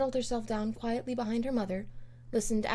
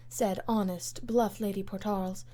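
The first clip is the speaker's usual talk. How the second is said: ordinary delivery, nothing marked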